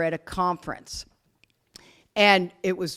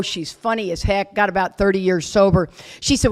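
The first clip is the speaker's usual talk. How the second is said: ordinary talk, nothing marked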